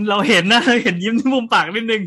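laughing loudly through the words. Real, not acted. Teasing the co-host over a hidden smile, laughing loudly.

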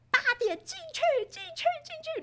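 very high, urgent shooing; shrill hurry-up push through the door; nagging at the gate. Retold or imitated. Mother shooing kids into school, very high and urgent. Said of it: "我就再也不争执说".

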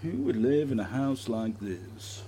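slow, drawn-out TV presenter impression; Lloyd Grossman. Showing the co-host an old party-piece impression, Lloyd Grossman voice. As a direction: stretch it out like a quizzical TV host. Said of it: "I used to do a good Lloyd".

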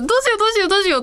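high, repeated fretting; mock panic. Raccoon clinging to her, she frets in mock panic. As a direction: fret over and over.

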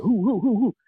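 imitating her high owl-like hoots; a retold woman hooting like an owl. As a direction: hoot each "who" up high like an owl. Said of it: "she sounded like an owl".

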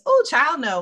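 high, loud "Oh, child" in mock dismay; Bobby's exasperated cry. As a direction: throw up your hands at the screen. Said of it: "Bobby says".

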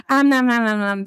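playful nom-nom eating noises; mock munching. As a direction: pretend to gobble food in quick bites. Imitates munching on a baguette.

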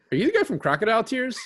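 excited quote. Retold or imitated. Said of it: "the guy's just like".

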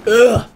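real groan of disgust; disgusted "ugh". Real, not acted. Co-host just tasted the beer, disgusted groan. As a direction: recoil with a short "ugh".

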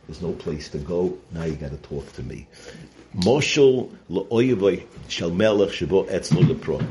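ordinary delivery

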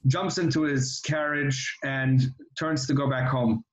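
ordinary talk, nothing marked

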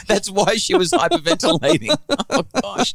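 real laughter breaking through the words; laughing. Real, not acted. Husband laughing on hearing his wife spoke to the daughter first, laughing. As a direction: laugh it out, caught off guard.

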